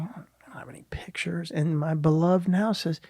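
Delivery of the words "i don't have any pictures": whispered, sheepish denial; mock whisper. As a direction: breathe it out like a guilty secret. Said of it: "I'm like".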